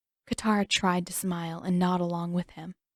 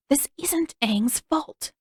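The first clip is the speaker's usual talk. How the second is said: hissed; 8 semitones higher, slower than usual. A narrator's reading. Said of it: "Katara hissed in reply".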